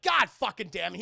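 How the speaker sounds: loud mock-angry swearing; exasperated curse. Agent finds prisoner beating guard, loud exasperated curse.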